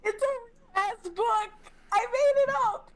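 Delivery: real high, tearful-sounding laughter through words; laughing protest. Real, not acted. Player insisting they made the book up, laughing protest. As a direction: squeal it out, helpless with laughter. Player cracking up at own invention.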